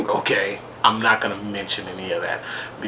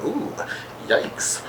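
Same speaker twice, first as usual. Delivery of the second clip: much higher, half-whispered mock gasp; high, soft hammed-up yikes; fake-sounding shock; 13 semitones higher, 4 dB quieter, slower than usual. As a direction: mimic a reaction that isn't real. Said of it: "this little weird thing".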